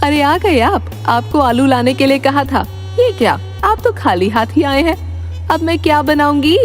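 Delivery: high-pitched, put-out wife's voice; complaining wife. Acted. Wife questioning her empty-handed husband, complaining.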